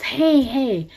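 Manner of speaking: high hailing greeting; imitated calling out. Man spotting them as they walk, high imitated hail.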